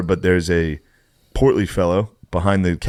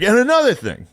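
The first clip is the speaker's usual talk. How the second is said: mock comeback. Imagined comeback.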